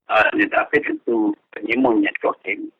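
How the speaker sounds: ordinary talk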